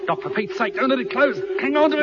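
high, frantic plea for help; urgent panic. Acted. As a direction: cry out while straining to hold something. Struggling to hold closing hatch.

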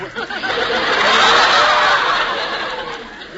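long high-pitched laugh. Acted. Laughs at Hubert's boast.

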